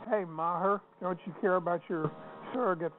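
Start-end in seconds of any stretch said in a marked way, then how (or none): none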